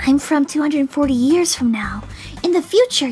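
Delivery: put-on loud, breathy girlish whisper; hammed-up secretive girl whisper; confiding stage whisper. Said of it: "lowered her voice and put her face closer".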